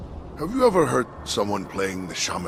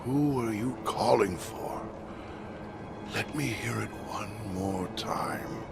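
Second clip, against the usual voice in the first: slow, hushed reverie, sinking into a whisper; entranced murmur; 2 semitones lower, 4 dB quieter than usual. Acted. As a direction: breathe it slowly, lost in the music. Entranced alone by distant shamisen music.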